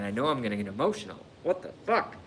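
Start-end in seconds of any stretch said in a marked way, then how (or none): 1.4-2.2 exasperated. Real, not acted. Frustrated at own tears.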